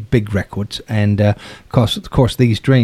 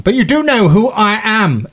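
pompous producer; 9 semitones higher, 3 dB louder than usual. Retold or imitated. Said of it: "I think he said for the third time".

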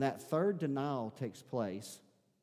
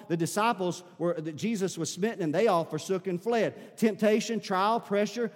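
loud, high, driving sermon delivery; high-pitched pulpit proclamation at full volume; full-force preaching; 8 semitones higher, 8 dB louder than usual. Acted. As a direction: preach it out hard to the whole congregation.

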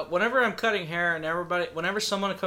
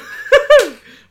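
sudden loud, high laugh; burst of laughter; 13 dB louder than usual. Real, not acted. Host tries to carry on the recap, bursts out laughing. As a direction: burst out laughing mid-word. Cracking up while trying to continue.

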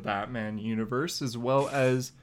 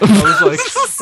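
laughing; 17 dB louder, slower than usual. Real, not acted. Laughing burst.

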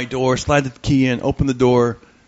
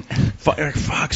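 ordinary talk

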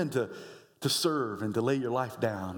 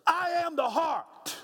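call to repeat; slower than usual. Shown as a demonstration. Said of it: "Say that with me".

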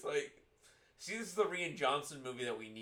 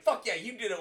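loud, high burst of approval; excited cheer; 9 semitones higher, 6 dB louder than usual. Acted. Parent reacting to what the kid did, excited cheer. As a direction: cheer it on emphatically.

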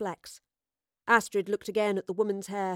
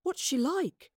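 eager, higher-pitched question; marvelling curiosity; 7 semitones higher, slower than usual. A narrator's reading. Gaius asks after the famous queen, eagerly marvelling. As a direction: ask as if starstruck. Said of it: "marvelled Gaius".

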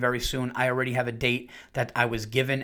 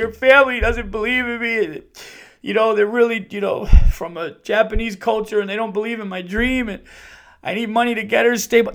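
high, loud tearful pleading; mock weeping; 8 semitones higher, 7 dB louder than usual. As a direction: imitate a man sobbing out his plea. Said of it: "This guy was weeping".

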